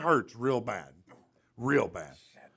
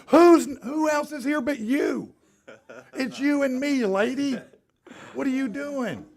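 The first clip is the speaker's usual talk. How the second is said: high, loud laughing put-on voice; shrill-voiced mock outrage; mock exasperated nurse; 8 dB louder than usual. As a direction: lampoon her indignant surprise.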